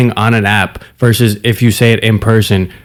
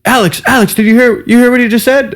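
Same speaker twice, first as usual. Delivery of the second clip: put-on much higher, urgent girlfriend voice; excited friend; 10 semitones higher than usual. Girl's friend telling her what he just said, high and excited. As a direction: imitate an excited friend spreading the news. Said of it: "the girl's friend heard and went".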